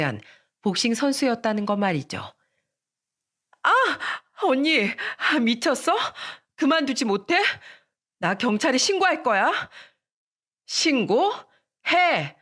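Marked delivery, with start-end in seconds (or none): 3.6-9.7 shrill angry protest. Acted. Sister yells at attacking sister. 10.7-12.4 defiant shout. Acted. Defiant reply to police threat.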